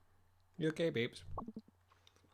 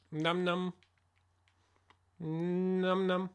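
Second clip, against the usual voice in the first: higher cutesy eating noise; playful num num; 3 semitones higher, much slower than usual. Pretend. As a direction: squeak it playfully. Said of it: "I'll stop playing".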